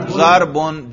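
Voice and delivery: "ضربن" ending clearly in -un; raf' ending. Shown as a demonstration. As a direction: say "ضربن" with a final "un" sound. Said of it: "رفع پہ تلفظ کرو". ("ضربن" رفع کے ساتھ، آخر میں "اُن")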